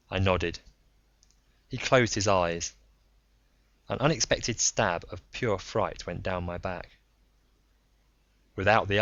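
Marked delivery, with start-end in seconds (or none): none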